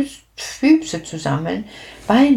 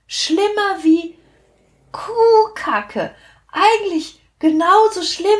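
high, disgusted exclaiming about the stink; disgusted narrator; 8 semitones higher than usual. A narrator's reading. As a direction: squeal at the stench, much higher. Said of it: "sag ich". (angeekelt, hoch und empört)

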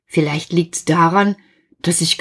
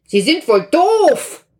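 irritated, higher and louder; annoyed caller; 9 semitones higher, 3 dB louder than usual. Acted. The lawyer's caller gets fed up, louder and higher. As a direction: snap at the stranger on the line.

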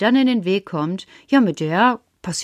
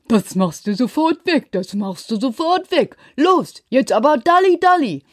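kleines Mädchen kommandiert, am Ende lauter; herrische Schwester; 3 semitones higher than usual. Acted. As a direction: befiehl es wie eine kleine Chefin. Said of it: "die sagt". (Puppa kommandiert ihre Schwester herum, zuletzt lauter)

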